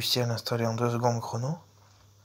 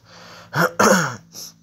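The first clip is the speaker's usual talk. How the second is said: throat clearing; 5 semitones higher, 9 dB louder than usual. Real, not acted. Pause before restarting intro.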